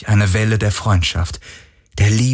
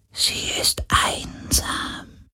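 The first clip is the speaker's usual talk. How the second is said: ghostly whisper; 8 dB quieter, much slower than usual. Acted.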